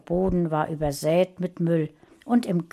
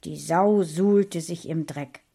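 plain reading voice

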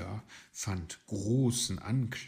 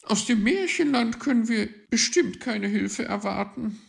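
dismissive magpie; 14 semitones higher, 10 dB louder than usual. A narrator's reading. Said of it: "winkte die Elster ab".

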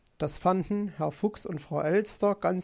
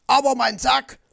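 high, loud protest; heated, raised-voice comeback; loud retort; 6 semitones higher, 8 dB louder than usual. A narrator's reading. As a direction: shout right back at her. Fox shouts back at magpie.

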